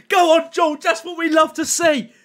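loud, high hype shout at the pack; hyped streamer yelling at a good pull; excited cheer. Real, not acted. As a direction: cheer the player in like a goal.

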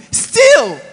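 high, loud burst on one word; sudden high shout; exasperated outburst. Real, not acted. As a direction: cry it out in exasperation. Exasperated emphasis on still begging.

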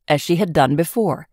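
ordinary delivery

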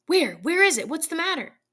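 high, startled questioning; startled awake and alarmed. A narrator's reading. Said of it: "He started up in alarm".

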